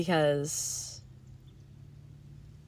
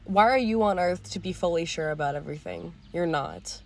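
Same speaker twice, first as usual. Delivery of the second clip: ordinary talk, nothing marked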